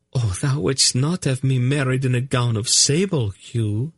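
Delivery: high noblewoman in dismay; high, courtly protest; high lady voice. Acted. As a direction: plead like a fretting noble lady.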